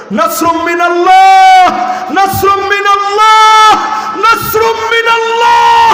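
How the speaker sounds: high, drawn-out shout, rising with each repeat; imitated child's cry. Preacher voices running children calling for God's help, high imitated shouts. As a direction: cry it out loud, stretching the last word. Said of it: "বাচ্চা দৌড়াইছে আর বলতেছে".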